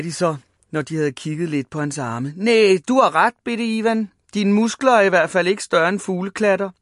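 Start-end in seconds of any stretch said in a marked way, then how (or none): 2.4-6.7 raised, louder taunt; jeering. A narrator's reading. Big boys judging Ivan's muscles, jeering. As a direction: jeer at the little boy.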